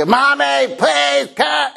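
high shouted call for mommy; child calling out. Retold or imitated. Pastor imitating his son yelling for mom, high shout. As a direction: call out like a five-year-old needing help. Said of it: "and he".

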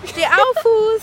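high playful order to the foot; mock command. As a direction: boss the foot around jokingly. Wakes sleeping foot jokingly. (spielerischer Befehl)

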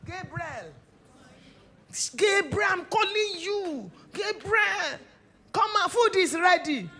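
put-on calling a child loudly across the house; yelling across the room. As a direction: shout the name to another room. Mother calling child to eat.

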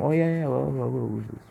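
mock foreigner voice.

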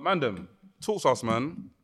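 normal talking voice

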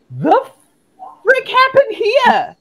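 high-pitched, drawn-out shocked squeal; hammed-up appalled outburst; comic disbelief. Coach voicing a visitor shocked by the website, shrieked disbelief.

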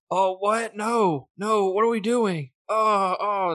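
high, frantic whimpering voice; panicked Wakka impression. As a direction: squeal it out like he's losing it. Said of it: "all you hear is".

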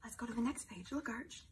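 normal talking voice

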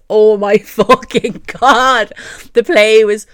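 loud, laughing, drawn-out gush; excited gushing. Real, not acted. Fan gushing to viewers about the play, loud and laughing. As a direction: let it burst out, laughing and loud.

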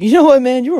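high, loud exasperated dad. Retold or imitated. Dad fed up with his high son, loud and high. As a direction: throw it up at him, fed up.

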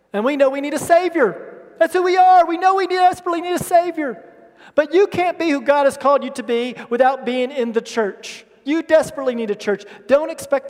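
loud, high preaching climax; preaching at full force. Acted. Preacher rallying the church, loud and high. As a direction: preach it out to the congregation.

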